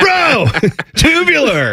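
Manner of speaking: high, slow surfer-dude exclamation; hammed-up cartoon surfer, stretching the vowels; surfer Ninja Turtle. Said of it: "You sound like a Ninja Turtle".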